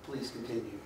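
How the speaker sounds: low, soft, falling command; flat experimenter. Retold or imitated. Experimenter to subject, quiet low insistence. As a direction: say it coolly, like a calm authority. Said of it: "And I go".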